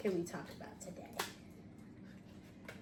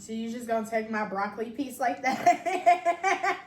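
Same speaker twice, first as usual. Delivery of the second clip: laughing protest; 3 semitones higher, 9 dB louder, slower than usual. Real, not acted. Broccoli taken, laughing protest.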